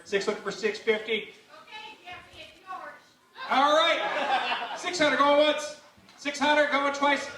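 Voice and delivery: fast rising auction chant; auctioneer. Acted. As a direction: call bids like an auctioneer. Live auction calling.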